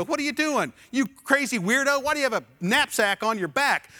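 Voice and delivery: high, loud jeering; mocking. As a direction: ridicule the odd passenger. People mocking the passenger.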